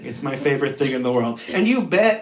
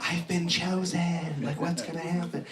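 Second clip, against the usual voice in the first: soft, slow, hushed wonder; quiet mock awe; awed hush; 10 dB quieter than usual. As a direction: breathe it like you've been chosen. Awed at wizard summons.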